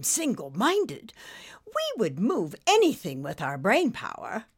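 stately British lady, swooping high; British dame thinking it through; Lady Meander. A narrator's reading. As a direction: muse grandly in a British accent. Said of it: "in a most British stately accent".

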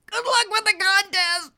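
high, strained farewell; squeezed voice about to burst; barely managing. Acted. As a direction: push the words out under pressure. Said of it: "Nimbus managed to say".

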